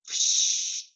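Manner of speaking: voiceless rushing whoosh; bus whoosh. Retold or imitated. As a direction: blow it out like air brakes. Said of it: "The bus stops".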